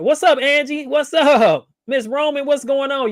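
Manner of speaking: high, loud, delighted hello to the new callers; excited greeting. Real, not acted. Host greets callers who just joined, excitedly. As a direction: call out a big happy welcome.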